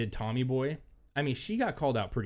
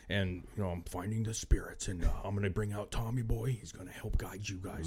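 mock spirit voice; 3 semitones lower, 5 dB quieter than usual. Medium channelling spirit guide Bill, mock lower and softer. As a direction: put on a solemn ghost voice. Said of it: "the ghost is in her".

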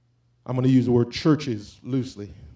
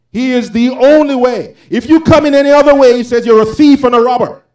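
preaching at full force; 7 semitones higher, 12 dB louder than usual. Real, not acted. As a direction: thunder the scripture to the congregation.

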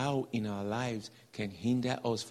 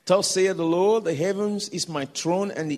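louder, higher solemn proclaiming; scripture proclamation; 5 semitones higher, 8 dB louder than usual. Real, not acted. Preacher reading Isaiah aloud, proclaiming. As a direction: proclaim the verse with authority.

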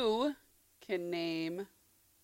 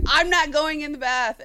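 imitating a child's loud refusal, pitched up; mock defiant kid; 4 semitones higher, 6 dB louder than usual. Mother imitating her daughter refusing a bath, mock defiant. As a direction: dig in like a kid refusing, louder and higher.

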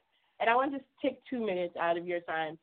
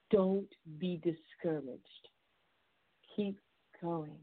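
low, slow and softening, word by word; gentle, deliberate reassurance; heartfelt encouragement; 4 semitones lower, 4 dB quieter, much slower than usual. Real, not acted. Host urging struggling listeners to keep going, tender encouragement.

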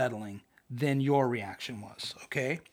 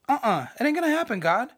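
defiant refusal; 9 semitones higher, 3 dB louder than usual. Acted. Jonah refusing God.

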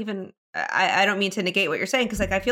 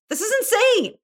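this is insane shot up high and loud; real shock at a coworker outing her friend; stunned disbelief; 13 semitones higher, 3 dB louder than usual. Real, not acted. Host stunned by the story, exclaiming in disbelief. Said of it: "my jaw is on the floor".

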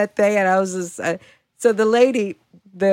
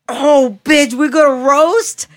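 loud, high, drawn-out mock challenge; eager challenge; 7 semitones higher, 6 dB louder, much slower than usual. Eager challenge in a retold confrontation.

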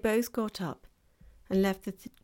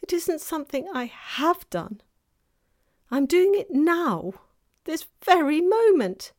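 high confession building louder; distressed faun; 9 semitones higher, 8 dB louder than usual. A narrator's reading. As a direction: build to an anguished wail as the faun.

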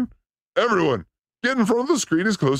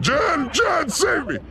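panicked screaming; much slower than usual. Real, not acted. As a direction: yell at Jen to save you. Screaming on a virtual coaster.

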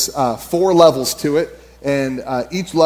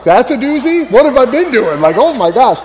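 incredulous; 7 semitones higher, 5 dB louder than usual. Retold or imitated. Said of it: "I'm like".

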